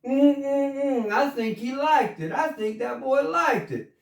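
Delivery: high, teasing mm mm mm; teasing ladies. Retold or imitated. Friends teasing the spanked boy, high and playful. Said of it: "the other ladies were like".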